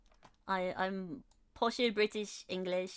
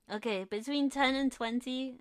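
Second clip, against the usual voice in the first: ordinary talk, nothing marked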